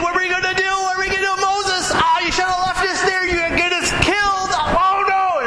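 high, frantic mock panic; squealing hammed-up panic; panicking crowd. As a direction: play the Israelites losing their heads. Preacher acts panicking Israelites.

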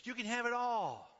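high, loud sales pitch; loud tempter. Pretend. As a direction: sell the world with a flourish. Satan's temptation.

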